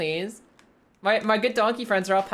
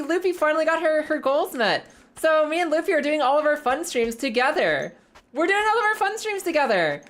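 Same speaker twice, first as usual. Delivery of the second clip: excited announcement; 9 semitones higher than usual. Real, not acted. Announcing joint streams with Loopy.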